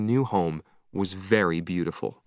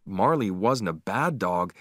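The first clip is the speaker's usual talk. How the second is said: ordinary delivery, nothing marked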